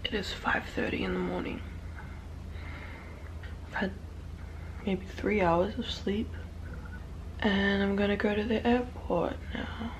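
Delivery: soft early-morning voice; hushed, just-woken murmur; sleepy quiet. Real, not acted. Five thirty, three hours of sleep.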